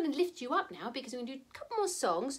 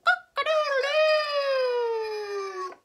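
high, loud, drawn-out rooster crow; 11 semitones higher, 6 dB louder, much slower than usual. Acted. Showing children the cockerel's call, crowing. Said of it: "that's more like a rooster".

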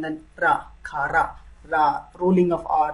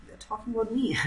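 laughing aside; 5 semitones higher, 7 dB quieter, faster than usual. Real, not acted. Laughing aside about the word.

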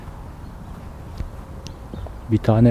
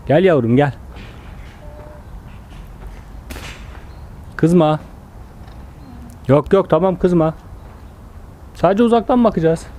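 higher, slowed soothing voice to calm the llama; soothing animal; 6 semitones higher than usual. Real, not acted. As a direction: gently coax the angry mother llama. Calming an angry llama mother. (sakinleştiren, yumuşak ses)

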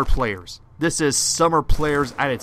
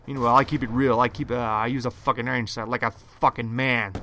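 usual speaking voice

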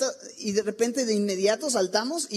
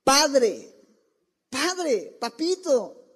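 pleading cry; 3 semitones higher, much slower than usual. Pretend. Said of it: "piensa en este clamor".

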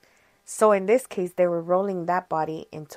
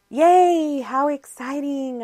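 mock excitement; 8 semitones higher, 7 dB louder, slower than usual. Sarcastic fake cheer at funeral job.

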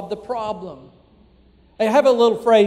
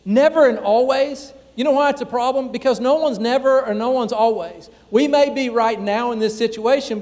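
ordinary talk, nothing marked

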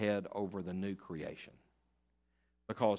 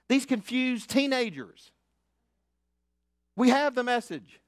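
loud, high, fervent preaching; preaching at full force; 11 semitones higher, 8 dB louder than usual. Acted. Pastor rallying his church, preaching loud and high. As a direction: lift them up with the message.

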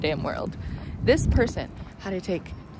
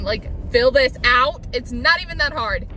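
ordinary delivery

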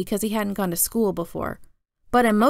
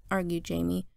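plain reading voice

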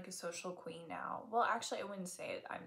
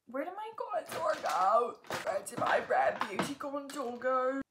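frantic search; 6 semitones higher, 7 dB louder than usual. Real, not acted. Hunting for makeup.

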